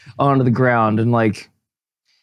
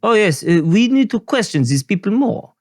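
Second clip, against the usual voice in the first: a hint of a French accent, higher; mock French accent; 7 semitones higher than usual. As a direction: keep the cheesy French character going, higher. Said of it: "you want me to go French".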